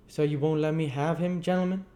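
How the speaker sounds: high, loud plaintive query; a rough tradesman asking at the door; chimney sweep. Acted. Gamfield asks the board from the door, high and plaintive. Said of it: "said Mister Gamfield, pausing near the door".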